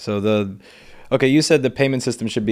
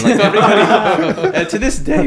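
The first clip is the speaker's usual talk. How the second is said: laughing; 4 semitones higher, 6 dB louder than usual. Real, not acted. Laughing through the story.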